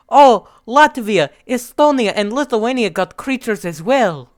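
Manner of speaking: put-on high, loud Russian-accented voice. Russia showing off the creatures to all his friends, Russian accent. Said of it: "okay, that accent was perfect".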